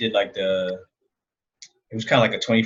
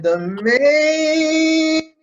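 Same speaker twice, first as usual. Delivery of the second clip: ring announcer; 8 dB louder than usual. Acted. Hyping the main event intro.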